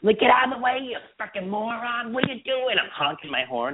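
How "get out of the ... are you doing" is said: much higher, mock road-rage yelling; imitating herself raging at drivers in Boston; aggressive Bostonian driver. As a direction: rant at other drivers, hammed up. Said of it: "I talk like a Bostonian when I'm driving".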